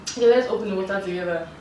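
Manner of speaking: ordinary talk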